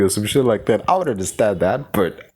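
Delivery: ordinary delivery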